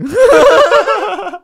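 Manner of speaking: loud, high burst of laughter; real high-pitched laughing fit. Real, not acted. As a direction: crack up loudly at your own remark. Laughing at own remark.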